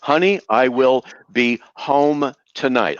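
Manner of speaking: slow, word-by-word delivery; measured recitation. Shown as a demonstration. Debater repeats the sentence he was told to say, measured recitation. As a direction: recite the dictated line slowly and deliberately.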